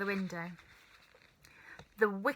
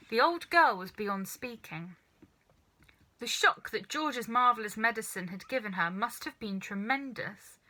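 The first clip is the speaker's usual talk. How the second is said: ordinary delivery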